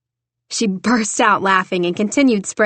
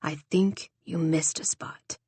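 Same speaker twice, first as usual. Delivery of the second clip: soft, slow teasing murmur; he teases her with a soft, slow line; whispered in his ear; 3 semitones lower, 9 dB quieter than usual. A narrator's reading. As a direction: lean in close and say it softly, slowly. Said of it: "whispered in her ear".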